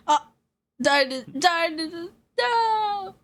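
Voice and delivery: rising wail of no; sudden game loss cry; dismayed cry. Real, not acted. Game character dies.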